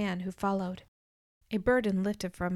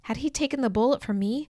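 usual speaking voice

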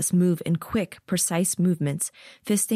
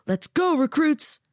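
hollering; 11 semitones higher than usual. A narrator's reading. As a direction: bark it across the yard. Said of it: "a soldier hollers".

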